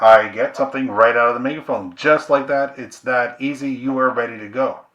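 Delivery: loud megaphone demo voice; loud, amplified product demo; talking through a megaphone. Shown as a demonstration.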